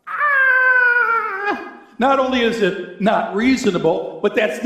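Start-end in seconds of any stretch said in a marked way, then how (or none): 0.1-1.8 high startled "Ah!" cry; shocked yelp. Retold or imitated. A sudden, high, aghast squeal. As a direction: pastor hears the mother's answer in court, shocked yelp. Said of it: "I'm going".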